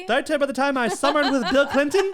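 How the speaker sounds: high, loud posh voice, laughing; lofty socialite bragging; snooty name dropper. Pretend.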